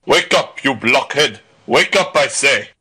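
bully rousing a sleeper; mild roar of a swaggering bully. A narrator's reading. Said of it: "he roared".

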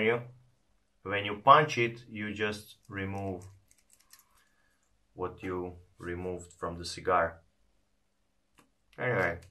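talking around pipe stem; pipe in mouth. Real, not acted. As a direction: teeth clenched on pipe. Seen 0: Pipe stem in mouth throughout; teeth-clenched hands-free from 3.0s.

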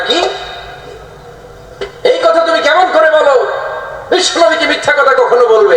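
high-pitched acted outrage; enraged. Father rebuking his daughter over her words, acted fury. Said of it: "রেগে মেগে আগুন".

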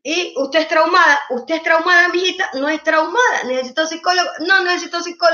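mocking the traumatized. Mother mocks talk of trauma.

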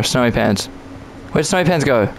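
ordinary talk, nothing marked

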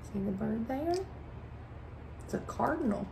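put-on soft hushed aside to children. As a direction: lean in as if not to scare the bird. Pointing out a hidden bird.

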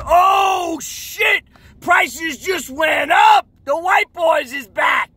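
high-pitched, drawn-out holler; loud, stretched-out shouts, way up high; mock alarmed shouting. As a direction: imitate a crowd crying out in dismay. Mimics rivals reacting at auction.

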